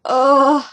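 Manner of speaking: loud dismayed groan. Retold or imitated. Fan groaning at rumors of a death, loud dismay. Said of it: "And I'm like".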